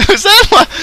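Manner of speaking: high, squeaky-pitched words through laughter; real laughter. Real, not acted. Gamer hearing a teammate mimic him, laughing. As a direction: barely get it out while laughing.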